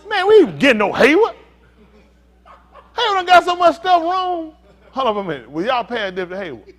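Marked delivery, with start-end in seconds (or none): 0.1-4.5 loud, high-pitched playful protest; mock complaint. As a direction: cry out in exaggerated dismay. Mock complaint during audience game.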